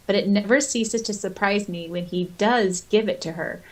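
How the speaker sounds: ordinary delivery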